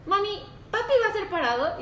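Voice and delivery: put-on little girl voice. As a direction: asks like a three-year-old in the bathroom. Mom voicing her small daughter.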